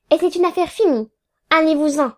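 high-pitched, firm send-off; curt dismissal. A narrator's reading. Lawyer getting rid of the pleader, curt dismissal.

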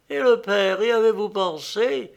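high, anxious question; trembling voice. A narrator's reading. Young woman asks her suitor about her father, trembling voice. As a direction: ask it high, with a touch of worry. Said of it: "d'une voix tremblante".

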